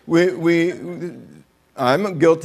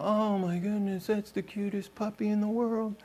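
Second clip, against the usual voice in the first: softer, cooing over a cute puppy; mock cooing; 6 semitones higher, 12 dB quieter than usual. Admirer cooing over the cutest puppy, soft mock coo. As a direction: gush gently like at a baby.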